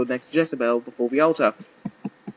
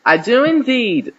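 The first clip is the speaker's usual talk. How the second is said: high, loud, drawn-out delight; gleeful bride's vow, stretched out; happily; 11 semitones higher, 7 dB louder than usual. A narrator's reading. Jezebel answering the archbishop at the altar, happily. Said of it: "She said happily".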